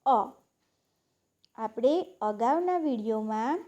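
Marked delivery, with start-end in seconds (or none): 0.0-0.2 અ said as a lone short "uh"; vowel sound demo. Shown as a demonstration. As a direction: sound out અ on its own for pupils. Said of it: "અને યુનો ઉચ્ચાર".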